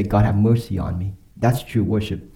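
normal talking voice

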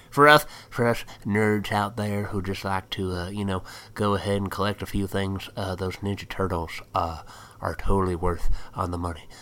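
lightly put-on American country accent. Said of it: "I just did a country accent for that".

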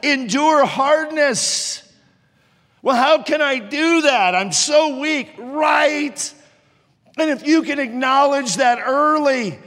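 high, driving pulpit voice; impassioned preaching. Real, not acted. Preacher urging ministry students to endure hardness, preaching at full force. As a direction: preach it hard, punching the key words.

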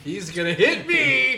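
high, loud laughing yell; laughing panic. Real, not acted.

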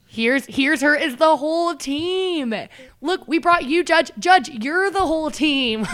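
loud, high, slow hammed-up proclamation; mock joking announcement. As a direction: present it grandly, as a running gag. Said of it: "It was a joke".

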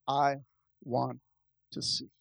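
soft, halting plea, word by word; quiet plea. Acted. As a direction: let each word fall softly, slowly. Blind man's plea to Jesus.

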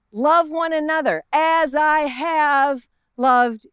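slow, drawn out, weighty verse; solemn verse. Acted. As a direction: lean on LOVE, slowly. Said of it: "solemn repeat of key verse".

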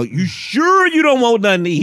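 put-on nervous boyfriend begging her to order; distraught guy on phone. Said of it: "looking so distraught".

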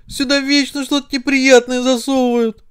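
high-pitched indignant cry. Acted. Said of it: "закричал язык". (возмущённый высокий крик)

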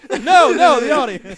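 loud laughing protest. Real, not acted. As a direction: laugh it off loudly.

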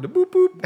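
high squeaky boop boop; boop sound effect. Shown as a demonstration. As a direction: chirp it like a buzzer. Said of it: "The thing that we actually need to have on our soundboard".

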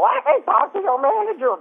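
high shrieky entitled customer voice; shrieky manager demand. Retold or imitated.

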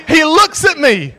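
high, loud, drawn-out retelling; emphatic shout. Retold or imitated. Storyteller getting back to the pastor's look, loud emphasis. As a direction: call it out slowly for comic weight.

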